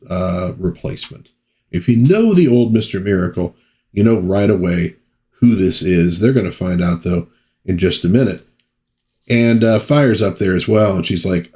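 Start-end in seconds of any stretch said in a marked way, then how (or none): none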